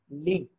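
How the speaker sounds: "li" said with no T at the end; silent T. Shown as a demonstration. Teacher showing the T of lit is not spoken. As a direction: stop cleanly on the vowel of "li". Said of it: "T is silent".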